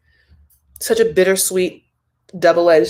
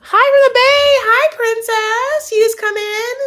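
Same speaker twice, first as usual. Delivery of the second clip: much higher, louder sing-song hello; excited greeting; 6 dB louder than usual. Real, not acted. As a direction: gush hello to newcomers like a host. Greeting viewers joining the live.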